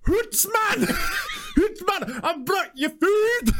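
high, hammed-up Scottish shout; bad Scottish accent. Delivery man calling him down, over-the-top mock Scottish accent. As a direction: holler it in a badly faked Scottish accent. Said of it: "You sound like the Englishman that says he's a quarter Scottish".

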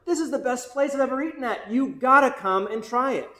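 acted-out enthusiastic recommendation; excited urging. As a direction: gush as if urging a friend along. Acted diner raving to a friend.

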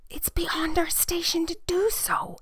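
high, slow whisper; whispered caution. Acted. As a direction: whisper it slowly as a cautious boy servant. Boy cautiously answers the girl.

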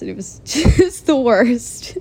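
real laugh breaking into the words; laughing hard. Real, not acted. Vlogger reliving her disastrous date, laughing hard. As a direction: crack up while finishing the sentence. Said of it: "I don't know why I'm laughing so hard".

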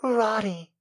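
high, soft cry of recognition; high damsel voice greeting her rescuer; heroine's cry. A narrator's reading. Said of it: "she cried".

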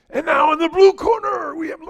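loud, high ring announcer call; stretched "blue corner" announcer cry; fight announcer. Retold or imitated. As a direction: boom it out like the MMA announcer.

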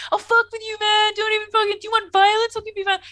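hammed-up tough-guy threat; hardcore Wesley. Wesley threatening the jock with violence, hammed-up fury. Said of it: "very, like, hardcore Wesley".

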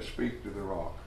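"Speak to the rock." said softly and low; God's new order "Speak to the rock." said gently; soft command. Shown as a demonstration. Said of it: "Only on that occasion, God said".

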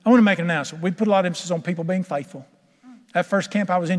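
normal talking voice

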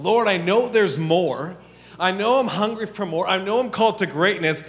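loud, stretched-out cry to God; impassioned prayer at preaching volume; fervent plea. Acted. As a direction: declare it with rising passion. Voicing a hungry believer's plea.

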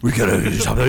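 rasping, growled mock hero voice; Batman. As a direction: growl urgently to the butler, hammed-up. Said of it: "do the voice alone".

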